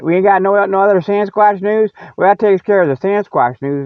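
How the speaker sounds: ordinary talk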